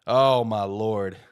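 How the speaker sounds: ordinary talk, nothing marked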